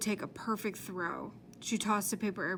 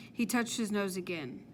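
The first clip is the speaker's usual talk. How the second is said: usual speaking voice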